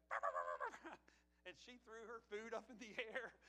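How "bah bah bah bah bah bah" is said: low, loud "bah" bursts to scare; deep, loud boo-like barking; spooky growl. Retold or imitated. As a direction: jump out at a child with a growl. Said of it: "he looked over and he went".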